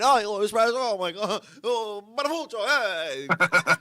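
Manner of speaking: high, loud mock-Italian outburst; hammed-up Italian excitement. Comic teasing his espresso binge, hammed-up excited Italian. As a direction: crow it high and loud, dragging out the name.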